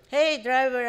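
loud call; shouted. A narrator's reading. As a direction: call out sharply across the snow. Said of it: "I shouted".

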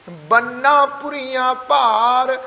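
slow recitation, ਬੰਨਾ pressed with a half h after the n. Shown as a demonstration. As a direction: lean on the nn of ਬੰਨਾ, draw out ਭਾਰ. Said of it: "ਥੋੜ੍ਹਾ ਜਿਹਾ ਦਬਾ ਦੇ ਕੇ". (ਬੰਨਾ ਦਬਾ ਕੇ, ਅੱਧੇ ਹਾਹੇ ਨਾਲ)

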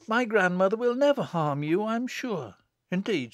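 calm her fears in a lifted, careful voice; gentle elder sister. A narrator's reading. Alison defending her grandmother to Susan, reassuringly.

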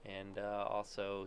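ordinary talk, nothing marked

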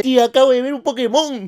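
grito agudo imitando a un primito; grito de niño. Retold or imitated. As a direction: grita como un niño chismoso desde el techo. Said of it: "empieza a gritar". (imitación aguda y fuerte de un niño gritando)

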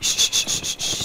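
soft unvoiced shush; soothing shush. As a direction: hush them gently. Calming someone down.

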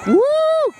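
high loud woo; whoop. Real, not acted. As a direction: whoop at the fireworks. Celebrating fireworks.

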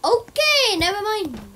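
loud, high startled okay; startled yelp. Real, not acted. Startled by a stranger, a loud high yelp. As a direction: jump back with a yelp.

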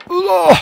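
very high, loud yelp; sudden shriek of fright; startled shriek. Real, not acted. Player sprinting through horror game, startled shriek.

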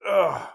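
stretching groan. Real, not acted. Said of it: "I'm stretching".

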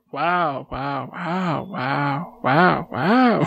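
rapid little wows, each inflected differently; clown's funny wow. Retold or imitated. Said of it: "as she described the guy says it".

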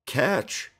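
a cheerful shout from above; friendly warning shout. Acted. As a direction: call down from up the ladder. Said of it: "he shouted".

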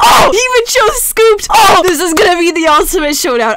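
loud, high excited yell; hyped shout. Real, not acted. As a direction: burst out with glee. Hyped by chosen game.

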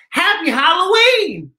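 ordinary talk, nothing marked

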